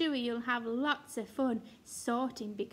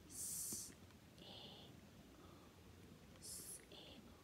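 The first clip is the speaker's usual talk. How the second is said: softly whispered letters and word; 22 dB quieter than usual. Shown as a demonstration. Teacher whispering the spelling to children. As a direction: keep it a secret hush. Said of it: "I'm going to whisper this one".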